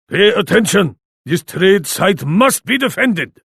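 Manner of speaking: normal talking voice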